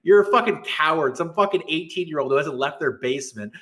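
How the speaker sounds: ordinary talk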